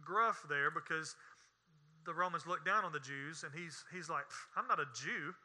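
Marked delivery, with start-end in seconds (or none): 4.6-5.4 low, quiet disdain as Pilate; disdainful. Acted. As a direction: brush it off with understated scorn. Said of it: "he said that with disdain".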